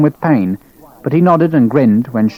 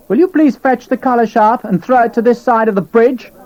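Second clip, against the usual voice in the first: prissy lieutenant; 11 semitones higher than usual. Acted. Lieutenant demanding, high voice.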